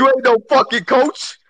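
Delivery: real high-pitched jeer; heckling outburst. Real, not acted. Listener rejecting his friend's coaching dream, high-pitched heckling.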